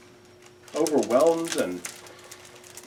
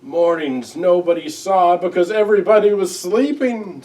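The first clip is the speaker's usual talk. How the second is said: higher, louder, tearful little owl; sobbing Owl; 5 semitones higher, 10 dB louder than usual. A narrator's reading. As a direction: plead it through tears, rising and loud. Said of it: "sobbed Owl".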